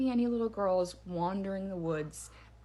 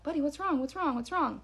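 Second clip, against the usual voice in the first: mildly alarmed, higher-pitched questions to the dog; anxious repeated check on a pet; worried questioning; 7 semitones higher than usual. Retold or imitated. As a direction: ask it urgently, voice raised in pitch.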